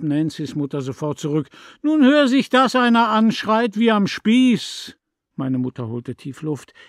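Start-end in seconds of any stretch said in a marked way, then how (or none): 1.8-5.0 high, loud jeering; loud mockery. A narrator's reading. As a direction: sneer at her screaming. Neighbour mocks the screaming.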